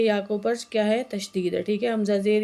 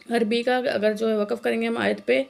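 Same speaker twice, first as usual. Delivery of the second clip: usual speaking voice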